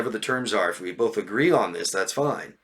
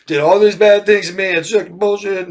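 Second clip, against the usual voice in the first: higher, louder, slowing on the last words; mock complaining; 7 semitones higher, 6 dB louder than usual. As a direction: whine it out like a wronged friend. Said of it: "They tell you all this bad stuff".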